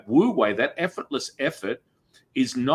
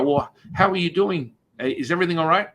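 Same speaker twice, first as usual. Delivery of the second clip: higher, brighter mock concern; mock concerned asker; 7 semitones higher, faster than usual. People asking how she is, in a higher mock-concerned voice. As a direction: imitate people fussing over you. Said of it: "people go".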